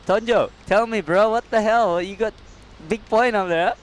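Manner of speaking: ordinary talk, nothing marked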